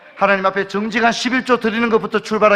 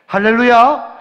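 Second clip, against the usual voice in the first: called hallelujah; 5 semitones higher, 8 dB louder, slower than usual. Acted. Preacher calls for response.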